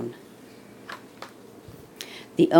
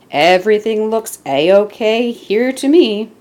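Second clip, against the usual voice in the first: policeman voice; 6 semitones higher, 6 dB louder than usual. Acted. Policeman reassures everyone.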